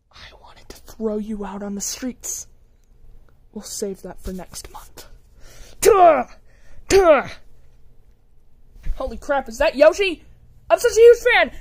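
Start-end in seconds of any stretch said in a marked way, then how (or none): 0.1-5.1 whispered threat. Acted. 10.7-11.5 excited fan shout. Acted. Fan meets Yoshi.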